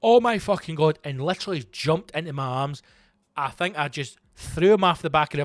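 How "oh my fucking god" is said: a retold yell, a bit higher and louder; imitating a frightened shout; mock shout. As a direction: replay his panicked outburst, lightly. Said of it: "He shouted".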